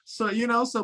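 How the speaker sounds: ordinary talk, nothing marked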